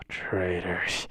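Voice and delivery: breathy sigh, then a low, quiet word; sighing resentment. Acted. Teacher left behind by two students, quietly aggrieved. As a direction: sigh it out, defeated and betrayed.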